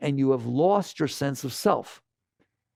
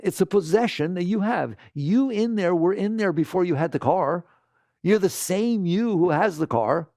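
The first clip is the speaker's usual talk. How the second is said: normal talking voice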